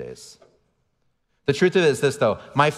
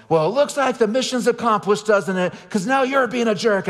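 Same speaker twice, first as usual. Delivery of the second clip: sarcastic yell; 10 semitones higher than usual. Retold or imitated. Dad loses it at his kids in front of security, sarcastic high yell. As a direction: throw your arms up and bellow it sarcastically. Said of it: "I yell".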